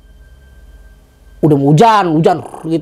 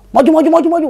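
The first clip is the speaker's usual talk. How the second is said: urgent urging; 10 semitones higher, faster than usual. Retold or imitated. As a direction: hurry them forward. Urging friends to run forward.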